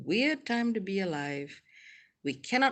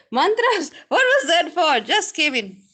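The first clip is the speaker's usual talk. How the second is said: high, loud, puzzled outburst; mock bewildered latecomer; 11 semitones higher, 11 dB louder than usual. Imitating a viewer who just came in, mock bewilderment. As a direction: play a baffled newcomer at full volume. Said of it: "Raymond's like".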